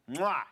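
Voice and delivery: quick smacked kiss noise; comic kiss sound effect; imitated kiss. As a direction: plant a mock kiss, "mwah".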